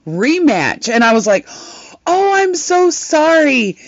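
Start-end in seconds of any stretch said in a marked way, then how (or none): 2.1-3.8 high, drawn-out sorry; mock apology. Streamer apologizing to a viewer, high and drawn out. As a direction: gush a sugary apology up high.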